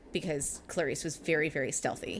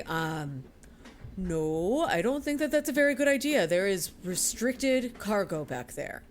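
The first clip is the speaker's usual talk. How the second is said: male porter voice. Acted. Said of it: "is going to say".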